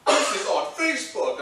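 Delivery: high, loud shocked cry; loud, high mock-shocked line. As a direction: gasp it out in horror. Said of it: "you were like".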